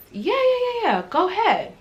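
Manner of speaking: high breezy imitation of the manager; lightly voiced manager waving her off; manager's breezy yes. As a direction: chirp the quick yes like the manager. Manager's quick permission.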